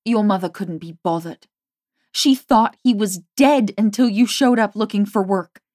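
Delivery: furious. A narrator's reading. Jamie's furious tirade.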